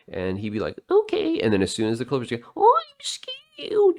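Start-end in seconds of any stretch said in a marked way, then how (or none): 0.9-1.4 high, loud kiddie falsetto; squeaky put-on child agreeing; mimicked little boy. Voices the bribed little boy agreeing. 2.6-4.0 very high, drawn-out mock squeal; exaggerated falsetto shriek of a scared child; monkey-like squawking child. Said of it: "you squawk, squawk, squawk".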